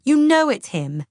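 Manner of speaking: loud, high exasperation; frustrated shout. A narrator's reading. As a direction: shout it, fed up. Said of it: "I shout in frustration".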